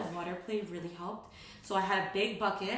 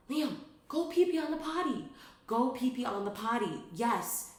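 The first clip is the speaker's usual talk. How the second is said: put-on high, insistent order to the toddler; a mother pushing her son to pee now; impatient command; 4 semitones higher than usual. Frustrated mom insisting her son pee on the potty. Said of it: "look at him and be like".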